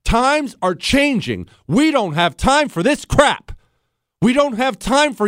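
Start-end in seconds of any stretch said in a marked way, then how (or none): none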